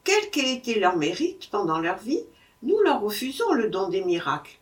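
ordinary delivery

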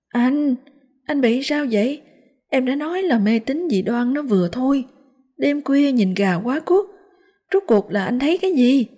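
high-pitched wife's voice; scolding wife. Acted. Wife chiding her husband for superstition, scolding. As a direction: pitch it high, exasperated with him.

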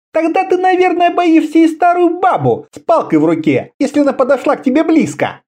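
high-pitched female taunt; mocking. A narrator's reading. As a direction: jeer at his cowardice in a high voice. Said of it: "издевательски спросила она". (издевательски, высоким женским голосом)

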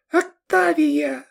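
put-on very high, drawn-out girlish falsetto; squeaking. A narrator's reading. Pyshechka giving Sergei her name, tiny high squeak. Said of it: "пропищала Пышечка".

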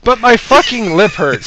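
whiny complaint. Retold or imitated. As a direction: whine it, hurt. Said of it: "I'm like".

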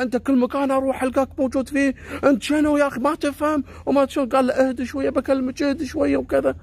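she's fed up, let it fly; angry outburst. Retold or imitated. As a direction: Khalida snapping at the stalker, high and furious. Khalida yells at stalker.

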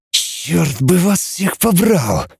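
slow hissed curse. A narrator's reading. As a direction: hiss it out slowly. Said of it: "прошипел Кнуров".

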